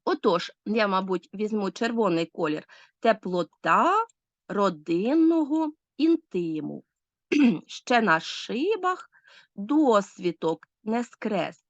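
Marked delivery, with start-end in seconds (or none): 3.0-6.8 теплота родинного інтиму slowly word by word, stretching ТА, ДИН, ТИ; slow scansion of "теплота родинного інтиму", each stress lengthened; marking word stresses. Shown as a demonstration. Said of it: "Я розставила наголоси". 7.7-11.6 ще на шибах досвіток не скрес very slowly, stretching ШИ, ДО, СКРЕС; slow scansion of "ще на шибах досвіток не скрес", pausing between phrases; marking word stresses. Shown as a demonstration. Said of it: "Я розставила наголоси".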